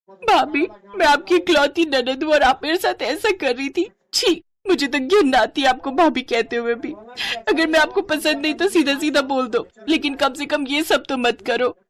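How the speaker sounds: high-pitched, put-on weeping complaint; tearful accusation. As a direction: play the wronged victim near tears. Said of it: "मत रो मेरी बच्ची". (रोते हुए शिकायत, ऊँची आवाज़)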